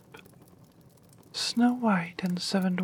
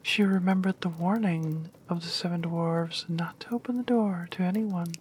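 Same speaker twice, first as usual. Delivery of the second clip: ordinary delivery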